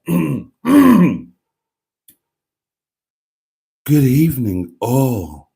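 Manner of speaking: hammed-up super sexy posh voice; slow, sultry send-up of a posh video presenter; Emma impression. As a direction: purr it out in a mock sexy posh voice. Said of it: "She puts on a super sexy posh voice".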